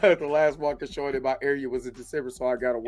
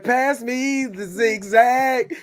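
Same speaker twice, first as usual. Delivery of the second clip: slow, higher mock stoner voice; stoner cartoon quote; 7 semitones higher, slower than usual. Telling a joke about Mickey, slow mock stoner voice. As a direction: drag it out like you're high. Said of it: "He said".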